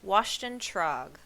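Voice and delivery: slow, deliberate call; drawn-out, projected summons. A narrator's reading. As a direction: call a name across a crowd. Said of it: "and called out".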